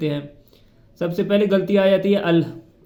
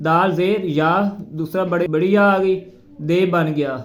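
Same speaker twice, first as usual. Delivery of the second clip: usual speaking voice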